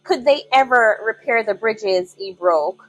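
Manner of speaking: plain reading voice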